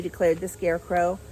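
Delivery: plain reading voice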